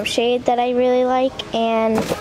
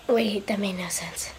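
whispered aside; 3 semitones lower, 9 dB quieter than usual. Real, not acted. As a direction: whisper it to yourself.

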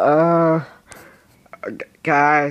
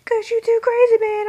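much higher, squeaky protest; 4 dB louder than usual. Retold or imitated. Teen gamer protesting to adolescence in a squeaky voice. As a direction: squeak it out like a cartoon kid. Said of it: "they're like".

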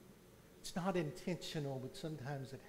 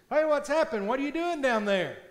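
loud, high call into the hole; passerby shouts down into the hole; 9 semitones higher, 13 dB louder than usual. Retold or imitated. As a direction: shout down to a stuck man.

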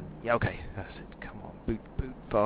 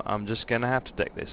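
ordinary delivery

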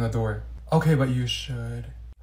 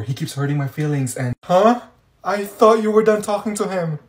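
ordinary talk, nothing marked

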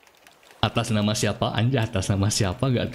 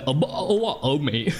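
higher-pitched, hammed-up British accent; mock British accent; 5 semitones higher, slower than usual. Streamer jokes to viewers about switching accents, mock British accent. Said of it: "Logatnya pakai logat British kah?".

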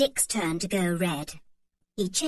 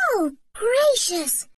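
put-on high, loud, slow childish groan; dramatic dismay; 13 semitones higher, 7 dB louder, slower than usual. A narrator's reading. Anne protests to Mother about lunch, dramatic. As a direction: overplay your hunger.

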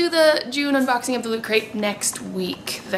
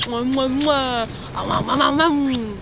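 between kisses. Acted. Kissing the baby.

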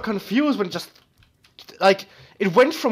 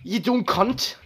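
higher, mock-angry Scottish accent; 5 semitones higher, slower than usual. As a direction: play an irate Scot cursing someone. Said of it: "think of the Scottish as being really fucking angry".

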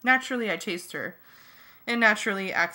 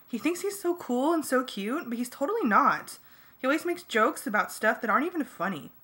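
put-on higher-pitched indignant girl; indignant party girl; 5 semitones higher than usual. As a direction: complain about him like an offended girl. Darlene mocking Marco.

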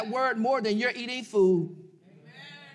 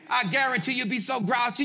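usual speaking voice

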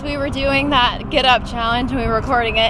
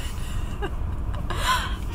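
squealing laugh; 10 dB quieter than usual. Real, not acted.

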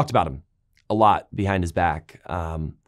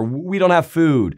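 whining; 5 semitones higher, 4 dB louder, slower than usual. Retold or imitated. People complaining.

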